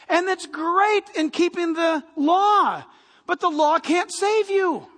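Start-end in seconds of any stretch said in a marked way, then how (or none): none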